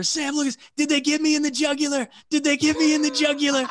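imitating high, loud panic; retold freak-out. Wounded gunner begs the interpreter to check, freaking out. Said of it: "he's just freaking out about".

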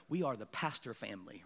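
low, hushed dad voice; imitated stern dad. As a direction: lay down the family rule, low and quiet. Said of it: "And he goes".

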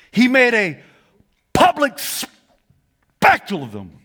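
high, loud, slow shouted preaching; preacher crying out "disarmed"; full-force preaching. Acted. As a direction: call the congregation to shout it back.

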